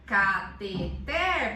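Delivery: loud, very slow ca-te-TER; measured classroom syllable count, last one strongest. Shown as a demonstration. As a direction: break it into separate clapped-out syllables. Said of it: "eu mando bater palma".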